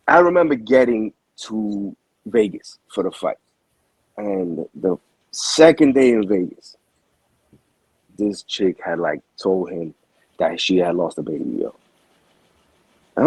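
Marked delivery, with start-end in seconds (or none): none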